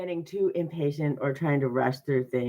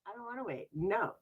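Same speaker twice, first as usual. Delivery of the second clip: sarcastic refusal; 7 semitones higher, 10 dB quieter than usual. Retold or imitated. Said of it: "it turns into sarcasm".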